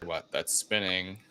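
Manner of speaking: usual speaking voice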